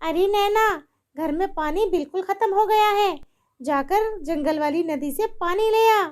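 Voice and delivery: high bossy mother-in-law; wicked mother-in-law. Acted. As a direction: order her about harshly.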